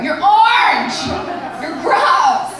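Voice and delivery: loud, drawn-out yell; demonstrated screaming. Shown as a demonstration. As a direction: scream it outside an ex's window. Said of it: "just screaming".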